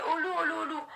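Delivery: high, repeated false-alarm call; mock cry for help. Storyteller imitates Pierre raising the daily false alarm, high mock cry. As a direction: call out high, imitating a boy crying wolf. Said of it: "il criait".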